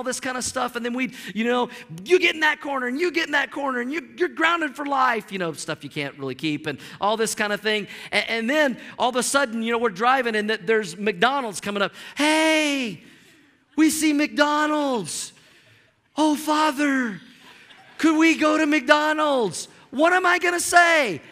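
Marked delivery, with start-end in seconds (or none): 2.0-5.3 high, rapid-fire mock telling-off; mock parental scolding. Father punishing his fighting kids, mock scolding. 12.2-19.7 high, drawn-out singsong begging; pleading kids. Acted. Kids spotting McDonald's beg their father, hammed-up pleading.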